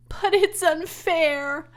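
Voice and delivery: high, loud, slow protest; girl crying out against injustice; cried out. A narrator's reading. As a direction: cry it out in anguish. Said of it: "cried Fern".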